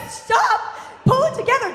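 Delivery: high, loud retold order; exasperated yell. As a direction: shout at mom to get a grip. Teen snaps at hysterical mother.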